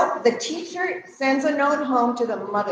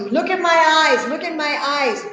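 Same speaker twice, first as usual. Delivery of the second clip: urgent plea; 4 semitones higher, 5 dB louder than usual. Acted. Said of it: "And you tell me".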